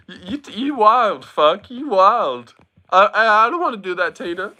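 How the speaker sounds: high, squeaky mock outrage; playfully scandalised at her; flustered squeal. Real, not acted. Flustered teasing back at Tina.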